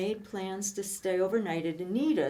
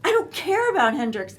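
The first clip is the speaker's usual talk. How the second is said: higher and louder, fed-up complaint; upset girlfriend; 7 semitones higher, 5 dB louder than usual. Retold or imitated. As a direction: snap back at the boyfriend's excuses. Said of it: "And I'm going".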